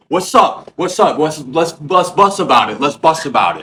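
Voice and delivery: loud, high, rapid-fire challenge; picking a fight. Retold or imitated. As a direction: shout it like you're squaring up to fight. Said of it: "he's, like, trying to fight".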